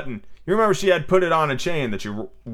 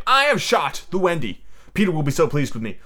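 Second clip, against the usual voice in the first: proud boast, higher at the start; proud cry; 4 semitones higher than usual. A narrator's reading. Toodles expecting Peter's praise, proudly. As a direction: announce it with pride. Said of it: "he cried proudly".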